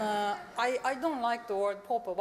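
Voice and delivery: ordinary delivery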